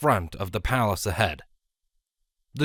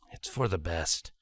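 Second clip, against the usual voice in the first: quiet; 5 semitones lower, 8 dB quieter than usual. A narrator's reading. Shining grimaces.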